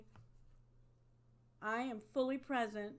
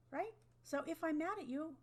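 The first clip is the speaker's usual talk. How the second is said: ordinary delivery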